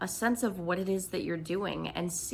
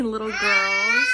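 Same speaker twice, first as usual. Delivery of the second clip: laughing; 9 dB louder, slower than usual. Real, not acted. Laughing at daughter's loud noises.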